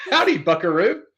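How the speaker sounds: loud, hammed-up cowboy greeting; cowboy howdy. Trying out Texan greetings for the show, loud mock cowboy. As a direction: belt it like a rodeo cowboy.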